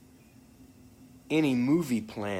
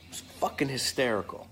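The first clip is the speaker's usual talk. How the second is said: normal talking voice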